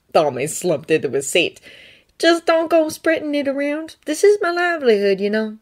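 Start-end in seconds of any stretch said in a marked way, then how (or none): 2.2-5.6 put-on higher, earnest young Jedi voice; Thonne's voice. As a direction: lift the voice up for Thonne, sincere and pleading. Thonne asks discretion.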